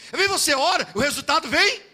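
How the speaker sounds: usual speaking voice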